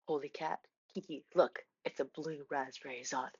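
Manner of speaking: low, soft hushed voice, awed; quietly awed friend; hushed amazed discovery. Retold or imitated. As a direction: breathe it like a secret find. Friend finds candy in bag.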